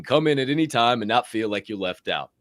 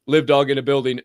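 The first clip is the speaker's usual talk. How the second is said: ordinary talk, nothing marked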